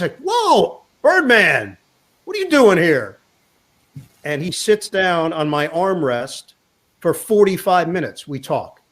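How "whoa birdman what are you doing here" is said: high-pitched, delighted double take; startled. Retold or imitated. Player spots broadcaster friend on the train, startled. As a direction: imitate a man caught off guard, pitched up. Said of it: "he's startled".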